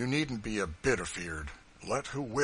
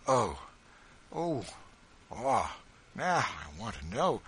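heart going out to the runaway; a touch of pity in a farmer's voice; pitifully; 3 dB louder, slower than usual. Acted. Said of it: "said the good man pitifully".

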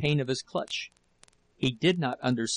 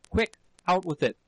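higher, urgent order; panting demand; 5 semitones higher than usual. A narrator's reading. Husband hurrying wife to confess, urgent heated demand. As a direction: urge them to confess now. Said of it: "he panted".

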